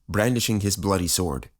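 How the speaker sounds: usual speaking voice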